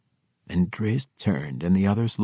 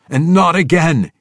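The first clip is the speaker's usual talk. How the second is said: loud stern warning; 12 semitones higher, 12 dB louder than usual. Acted. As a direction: cut him off hard. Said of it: "he warned".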